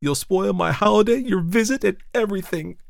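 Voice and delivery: high, tearful wail of complaint; sobbing. A narrator's reading. Sister fears her holiday is spoiled, sobbing. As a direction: wail it as if through tears. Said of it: "sobbed Elsie".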